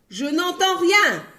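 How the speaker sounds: yelled. Acted. Milkman yelling he hears nothing. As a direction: yell it out. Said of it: "hurla le laitier".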